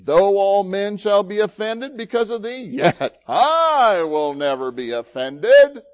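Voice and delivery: loud, emphatic vow, stretched on "I"; bold boast. Acted. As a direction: proclaim it with puffed-up confidence. Preacher acts Peter's bold boast.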